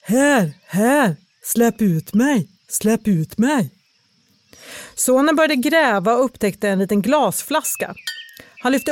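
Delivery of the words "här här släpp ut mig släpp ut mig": slow, stretched hollow call; trapped spirit, drawn-out plea; muffled voice. Acted. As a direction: answer dully from inside the bottle. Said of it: "Den dova rösten svarade".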